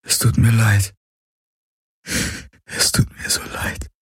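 hushed, breaking into a bare whisper; whispered apology. Acted. Man apologises to his lost love, whispering. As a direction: apologise under your breath, fading to whisper. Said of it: "flüsterte er".

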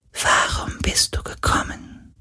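soft, breathy spirit whisper; whispering ghost. Acted. Ghost quietly asks Galen why he came, whispered. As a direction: ask from far away.

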